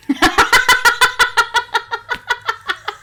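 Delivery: high, loud peal of laughter fading out; long uncontrollable cackling laugh; big helpless laugh. Real, not acted. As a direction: crack up for real, far too much. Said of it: "You laughed a little bit too much".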